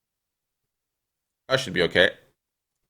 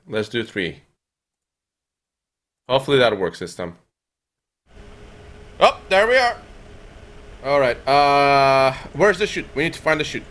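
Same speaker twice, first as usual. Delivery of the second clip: ordinary delivery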